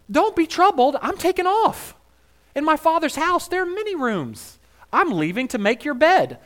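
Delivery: high, loud, cheery take on Jesus; breezy mock Jesus. Preacher voicing Jesus to disciples, high and chirpy.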